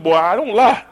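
grandma's voice. Retold or imitated. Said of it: "He said".

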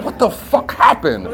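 low, soft, dazed question; lightly acted dazed waking; mock waking bewilderment. Someone waking from a blackout days later, low mock bewilderment. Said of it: "wake up ... be like".

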